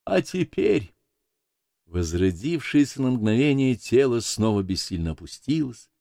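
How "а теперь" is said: high, solemn proclamation falling at the end; sermon opening. A narrator's reading. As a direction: launch a sermon with raised pitch. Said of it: "как бы перед началом проповеди". (высоко, как начало проповеди)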